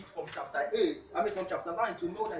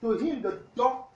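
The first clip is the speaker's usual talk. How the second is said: loud, fast sermon reading of the verse; preaching scripture reading; 8 dB louder than usual. Real, not acted. Pastor reading the verse to the congregation, preaching loud. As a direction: boom out the scripture to the church. Reads scripture at full force.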